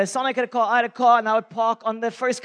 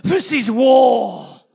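slow, much louder battle cry; declaring war; 9 dB louder, much slower than usual. Retold or imitated. Preacher retelling his fury at the neighbour, slow loud cry. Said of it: "I was".

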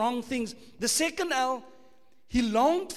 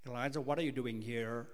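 lightly voiced American accent, soft and low; a hint of an American God asking; 9 semitones lower, 5 dB quieter than usual. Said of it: "If you're from America, God will say".

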